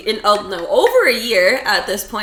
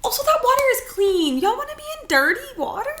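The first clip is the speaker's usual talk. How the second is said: incredulous; 13 semitones higher than usual. Real, not acted. Incredulous rant about dirty water.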